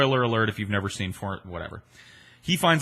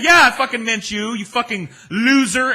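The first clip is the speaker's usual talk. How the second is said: loud high mock yell; retold yell; 13 semitones higher, 9 dB louder than usual. Anna Nicole confirming the insult to him, mocking yell. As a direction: spit it back at him. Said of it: "She's like".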